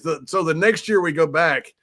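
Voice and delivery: ordinary delivery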